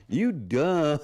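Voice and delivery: high, hammed-up Southern mock yell; playful drawn-out Southern yell; imitated Southern holler. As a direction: mimic the Southern neighbor for a laugh. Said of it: "what me and Samantha do".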